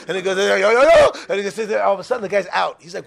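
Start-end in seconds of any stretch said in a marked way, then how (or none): 0.4-1.1 loud yo's rising in pitch; panicked shouting. Acted. Driver to passenger passing out, panicked shouting. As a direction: shout each yo more urgently.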